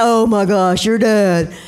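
low, exasperated groan of complaint; mock disgusted put-down; slamming dad. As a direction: grumble it low, fed up. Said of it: "slamming their dad".

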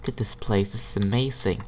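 awed whisper. Real, not acted. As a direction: breathe it out in wonder. Awed by magical cave.

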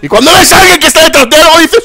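usual speaking voice

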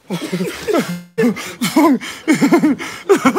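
high burst of laughter; laughing. Real, not acted. Laughing at joke.

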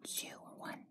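soft whispered wondering. Real, not acted. Whispering to viewers while counting the babies. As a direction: breathe the question out.

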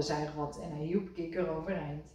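usual speaking voice

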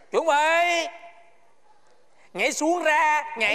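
high, drawn-out drill order; called-out command. Acted. Guard ordering two men to jump, called-out command. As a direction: call each command out clearly.

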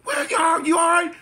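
very high, panicked calling; imitated hollering mother. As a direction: call out like a frantic mother upstairs. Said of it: "She was hollering".

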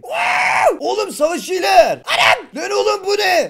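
very high, loud amazed yelling; real shock and excitement; excited shout. Real, not acted. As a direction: shout in disbelief at the horde. Army of warriors spawns.

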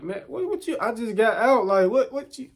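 high-pitched stammering disbelief; panicked protest. Retold or imitated. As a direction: stammer high, pleading that you just got out.

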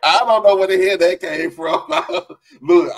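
real laughter breaking into words; laughing. Real, not acted. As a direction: laugh it off, amused. Laughing at friend's remark.

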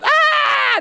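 very high mock scream of panic; fleeing scream. Preacher imitating the fleeing army's panicked scream. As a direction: yelp like a fleeing soldier. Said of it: "crying out as they fled".

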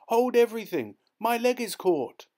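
high, loud frantic plea; panicked cry. A narrator's reading. Caught boy yells to workers, high and frantic. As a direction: shout it out in panic. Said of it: "he cried".

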